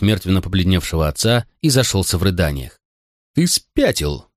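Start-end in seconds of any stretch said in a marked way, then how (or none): 3.3-4.3 a touch of hoarseness, pitched high; mildly husky, higher outburst; hoarse exclamation. A narrator's reading. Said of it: "хрипло сказал консул".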